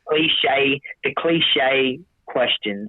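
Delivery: slow, drawn-out emphasis. Real, not acted. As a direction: stretch it out slowly. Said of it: "slowed emphasis".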